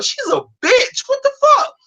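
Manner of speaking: real disbelief climbing high; outraged. Real, not acted. Ranting to a friend about a demanding bride, outraged high voice. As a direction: pitch shoots way up, slow and incredulous.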